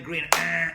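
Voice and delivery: short loud mock honk; horn honk. Teacher mimicking an American driver honking at green. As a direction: tap the horn once, loud.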